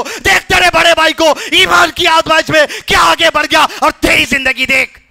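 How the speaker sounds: high sermon voice building to shouted exhortation; impassioned preaching. Real, not acted. Preacher telling believers about martyrdom, building to fervent preaching. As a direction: build steadily to a forceful, high-pitched cry.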